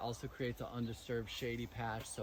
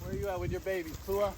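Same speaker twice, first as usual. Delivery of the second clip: higher, sing-out call to an animal; calling a pig; 8 semitones higher, 3 dB louder than usual. Real, not acted. Farmer looks for Pua and her babies, calling.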